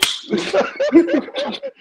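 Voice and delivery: high, loud burst of laughter; big laugh. Real, not acted. Co-host reacting to the stupid line, big high laugh. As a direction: crack up at the joke.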